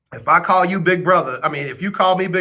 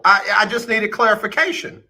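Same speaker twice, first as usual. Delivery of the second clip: ordinary talk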